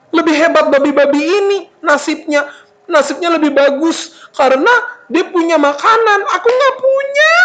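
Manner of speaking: high, hammed-up whiny lament; self-pitying whine. Hungry son envies the pigs their food, whining. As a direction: whine upward into a wail. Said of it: "Terus dia sedih".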